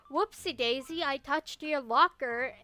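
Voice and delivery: mock innocent. Bullied kid needling classmates over a touched locker, mock innocent.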